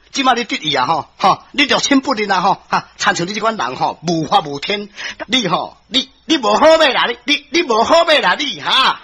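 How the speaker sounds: indignant, stammering scolding with exasperated huffs; angry old beggar. Acted. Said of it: "一寡乞食阿叔生气啊". (乞食阿叔受氣大罵)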